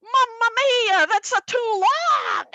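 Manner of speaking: high, loud mock Italian accent; hammed-up Italian accent outburst. Dad complaining in mock Italian accent. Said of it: "my dad turned Italian".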